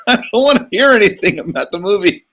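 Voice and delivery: high, fast, laughing through dad's line; laughing dad quote. Retold or imitated. Retelling dad's spoiler ban, laughing. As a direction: giggle while quoting dad, quick and high. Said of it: "My dad's like".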